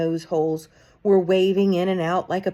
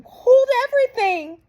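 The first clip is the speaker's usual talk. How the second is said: much higher, loud shrieking cry; 8 dB louder than usual. A narrator's reading. Witch in the back row stops the crowd, shrieking. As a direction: shriek it out to stop everyone. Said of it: "Her shrieking voice echoed".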